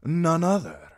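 sellsword boasting to the queen; flamboyant sellsword. A narrator's reading. Daario voice.

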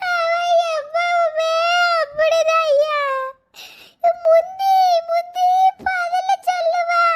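loud, high, slow crying protest; tearful shouting. Acted. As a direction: shout it through sobs. Woman shouts through tears. (அழுகையுடன் கத்தல்)

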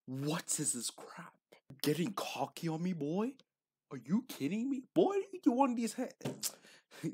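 high, loud mocking taunt; hammed-up taunting bully. Mocking taunt at old self.

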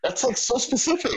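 high amused remark through laughter; laughing. Real, not acted. Telling the host about the question, high amused laugh. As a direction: laugh at how oddly specific it is.

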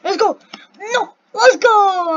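high, loud cheering; triumphant shout. Real, not acted. Player who just made it, triumphant shout. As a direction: shout it out in victory, stretching the last "go". Makes it through.

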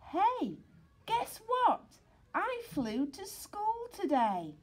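high, eager news to the class; bursting to share a secret; young dog's breathless boast; excited pup. Acted. Sid excitedly telling classmates.

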